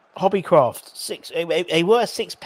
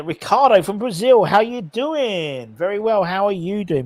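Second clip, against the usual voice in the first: higher, singsong welcome with drawn-out words; hammed-up greeting; 6 semitones higher, 4 dB louder than usual. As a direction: greet a viewer in an exaggerated lilt. Hammed-up greeting to viewer in Brazil.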